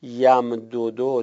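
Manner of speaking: "یمددو" stretched out very slowly syllable by syllable; drawn-out teaching example "یمددو"; slow spelled-out demo. Shown as a demonstration. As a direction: say "یمددو" slowly so each letter is heard. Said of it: "مثل مدده که گفتیم".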